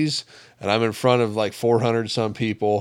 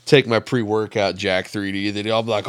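ordinary talk, nothing marked

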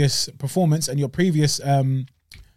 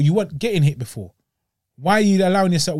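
normal talking voice

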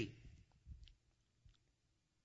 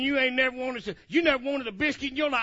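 ordinary talk, nothing marked

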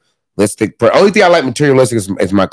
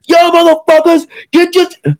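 high-pitched, excited holler at the guys; rowdy gym yell. Retold or imitated. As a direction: holler across a busy gym. Yelling around the gym, then phone rings.

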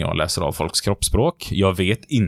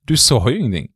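startled; 5 semitones higher than usual. Retold or imitated. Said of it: "Så här".

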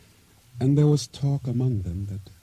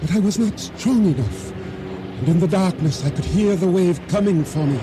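higher, urgent storytelling as the wave hits; breathless climax; 7 semitones higher than usual. Acted. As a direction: build the panic of being swept under. Narrator's climax of explosion and drowning.

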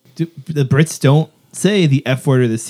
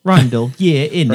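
mock British accent. Teasing a co-host over his British name, mock British accent. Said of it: "that's a very British name".

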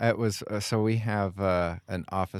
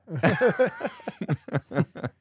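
bright laugh pitched up, falling away; real laugh; 10 semitones higher, 4 dB louder than usual. Real, not acted. Cracking up at the end of the officer story, real laugh. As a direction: laugh at the punchline.

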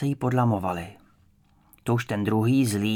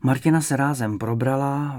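usual speaking voice

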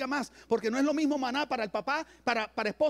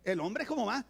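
usual speaking voice

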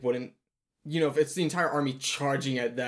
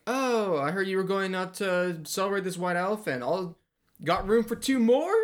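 sweetly scheming woman; 8 semitones higher than usual. Retold or imitated. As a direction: invite yourself along with fake sweetness. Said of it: "And then she's like".

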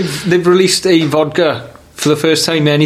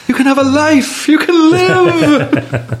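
high, drawn-out cheer for freedom; hammed-up joyful proclamation, laughing; mock liberation cry; 12 semitones higher, much slower than usual. Friend cheering that the mother can live freely.

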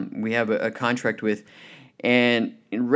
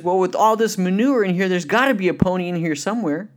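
a lightly voiced eager kid, higher; cheerful boy; 7 semitones higher than usual. Optimist boy cheerfully explains himself to the psychiatrist. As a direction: chirp it like a hopeful child. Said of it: "He says".

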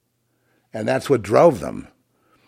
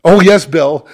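high, loud gushing; hammed-up adoring yes, much higher and louder; mock fawning fan; 7 semitones higher, 12 dB louder, slower than usual. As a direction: coo adoringly at Bill.

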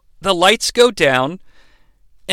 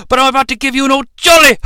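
fast, higher lecherous yell; leering mock shout; 6 semitones higher, much faster than usual. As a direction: rush it out like a dirty old elf. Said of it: "grin on his face like".

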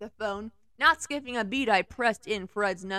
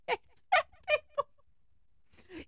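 laughing; much slower than usual. Real, not acted. Laughing while speaking.

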